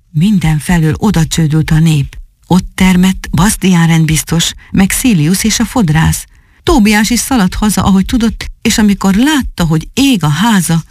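usual speaking voice

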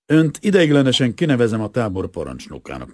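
brisk military appointment; curt, military. A narrator's reading. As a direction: announce it like a commanding officer. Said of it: "mondta kurtán és katonásan".

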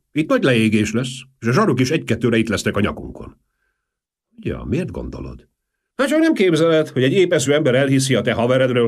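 high, loud, fast, alarmed; agitated worrier. A narrator's reading. Valmier warning Marcel of the police, alarmed and fast. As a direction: rush the warning, panicky.

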